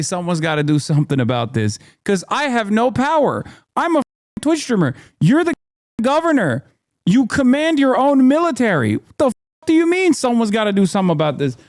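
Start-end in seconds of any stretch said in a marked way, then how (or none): 2.7-11.6 much higher, pushing each phrase; outraged disbelief at the governor; incredulous rant. Real, not acted. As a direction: pitch jumps high in exasperation. Ranting at the governor's excuse.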